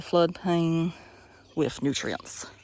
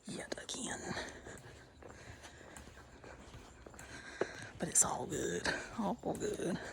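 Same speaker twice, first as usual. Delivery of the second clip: whisper; 12 dB quieter, slower than usual. Real, not acted. Quietly passing another hiker.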